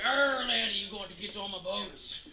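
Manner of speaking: bad pirate accent. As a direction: do a not very good pirate accent. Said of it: "not a very good pirate accent".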